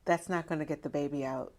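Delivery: soft, understated mock scolding; hushed, dismissive retelling; imitated dismissive nurse. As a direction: quietly imitate a nurse shutting someone down. Imitates dismissive nurse during labor.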